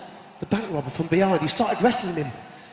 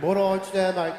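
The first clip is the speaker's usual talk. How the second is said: ordinary talk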